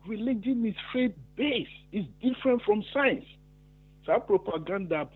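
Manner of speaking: high-pitched, agitated outburst; heated rant. Real, not acted. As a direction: pour out the frustration, voice climbing high. Caller rants passionately about religion and science.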